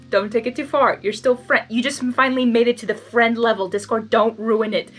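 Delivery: usual speaking voice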